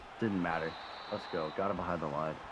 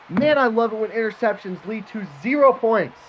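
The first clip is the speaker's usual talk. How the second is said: sarcastic outburst; 10 semitones higher, 8 dB louder than usual. Real, not acted. Sarcastic rant after turnover.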